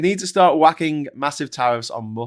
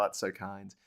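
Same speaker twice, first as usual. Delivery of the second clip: ordinary delivery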